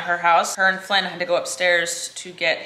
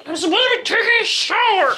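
I was supposed to be taking a shower, much higher and louder; mock outrage; 12 semitones higher, 5 dB louder, faster than usual. Real, not acted. Blaming the pet for the missed shower, higher and louder mock outrage. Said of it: "You distracted me".

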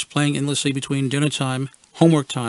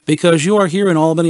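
put-on assured kid; 3 semitones higher, 6 dB louder, faster than usual. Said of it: "they assured me".

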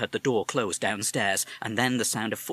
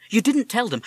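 put-on young boy; 13 semitones higher, 8 dB louder than usual. Boy alarmed.

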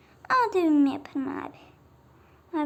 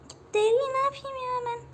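exasperated; 8 semitones higher than usual. Real, not acted. Annoyed at getting leather.

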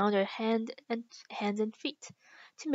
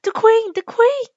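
loud, high cry of warning; high-pitched warning shout; alarmed calling out; 11 semitones higher, 13 dB louder than usual. A narrator's reading. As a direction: call it out loud and high, twice over. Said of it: "called out".

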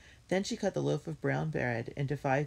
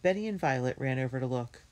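ordinary delivery

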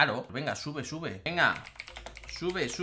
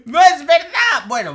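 protesta a gritos; 11 semitones higher, 16 dB louder than usual. Acted. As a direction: shriek an outraged denial. Protesta en la pelea del chat. (grito agudo de protesta)

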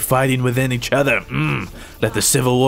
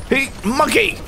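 yelling a name; 13 semitones higher than usual. Real, not acted. Yells at the enemy Winston by name. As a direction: yell at the enemy to hook him. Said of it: "yelling out a character's name".

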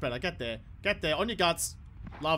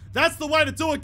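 higher, louder cheer of praise; excited cheer; 7 semitones higher, 4 dB louder than usual. Real, not acted. Gamer cheering his batsman's shot, excited praise.

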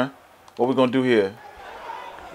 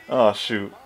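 ordinary delivery

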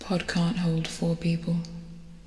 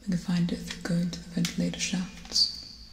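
ordinary talk

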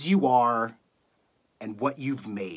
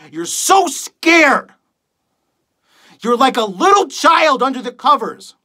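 impassioned rant climbing in pitch; scornful tirade; 2 semitones higher than usual. Acted. Radio host berates millions of listeners for their fear, scornful tirade. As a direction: build from grim address to raised-voice outrage. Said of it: "reading a monologue for you guys".

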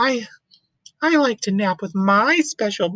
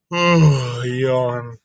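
much lower, sleepy yawn; a big lazy elephant yawn; deep yawning elephant; 11 semitones lower than usual. Acted. Gerald waking from his nap, deep and sleepy.

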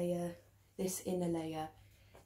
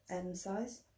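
ordinary talk, nothing marked

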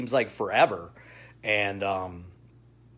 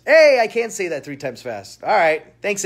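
ordinary delivery

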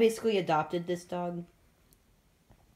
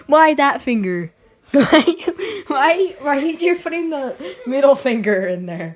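louder, giggling through the question; laughing; 4 semitones higher, 7 dB louder than usual. Real, not acted. Teasing a friend over the middle finger, laughing. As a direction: laugh while asking.

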